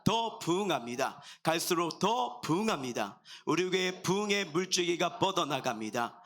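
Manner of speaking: rhythmic faith proclamation, phrase by phrase; congregational declaration. Acted. Pastor leading church in faith declaration, rhythmic proclamation. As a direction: proclaim each line firmly for the congregation to join.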